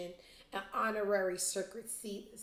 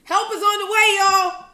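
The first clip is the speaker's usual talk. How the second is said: high, loud announcing holler; mock rallying shout; 13 semitones higher, 16 dB louder than usual. Vlogger mock-reassuring viewers about Space Boy, high shouted call.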